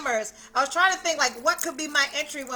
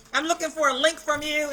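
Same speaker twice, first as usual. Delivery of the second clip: ordinary talk, nothing marked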